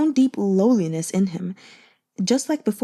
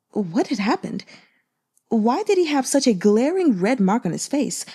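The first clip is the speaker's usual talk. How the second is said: plain reading voice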